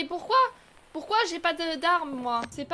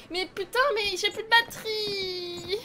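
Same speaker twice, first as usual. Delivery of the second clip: panicked whine; 5 semitones higher, 4 dB louder than usual. Real, not acted. Panicking gamer, battery dies.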